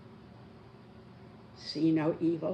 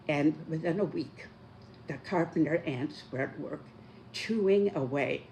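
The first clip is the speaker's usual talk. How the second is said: normal talking voice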